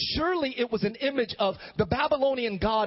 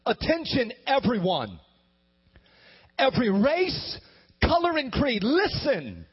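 higher and louder, proclaiming to the crowd; loud herald proclamation; 5 semitones higher than usual. Acted. Herald announcing the king's decree to everyone, loud proclamation. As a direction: call it out like a royal herald. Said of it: "in a loud voice".

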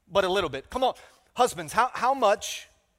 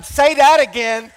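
mock shout; 5 semitones higher, 11 dB louder, slower than usual. Imitating wife's shouted response.

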